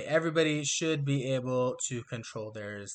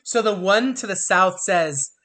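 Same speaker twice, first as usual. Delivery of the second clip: usual speaking voice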